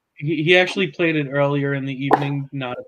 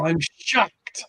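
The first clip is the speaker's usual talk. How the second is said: mock shock; 3 semitones higher than usual. Mock surprise at friend's lateness.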